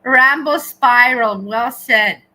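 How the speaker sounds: slow, booming call of the name; loud announcement. Real, not acted. Streamer booming a chat name out loud. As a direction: shout it out slowly, like an announcer.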